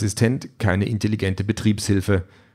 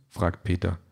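ordinary delivery, nothing marked

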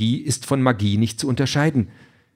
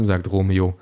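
ordinary delivery, nothing marked

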